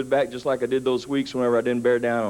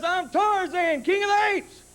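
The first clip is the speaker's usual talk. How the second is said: put-on high, slow boastful kid; proud kid Tarzan; slower than usual. Brother imitating little brother playing Tarzan, high and slow. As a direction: announce it like a jungle hero. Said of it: "He says".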